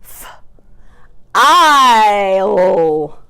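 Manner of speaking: "f-, isle" split, vowel held very long; chopped-out word. Shown as a demonstration. Teacher swapping the middle sound, drawn out slowly. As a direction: stretch FILE into separate sounds. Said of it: "change that middle sound to".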